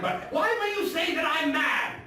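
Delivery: high, loud outburst of protest; indignant shout. Acted. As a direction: fling the question at the listener, outraged. Madman protests his sanity.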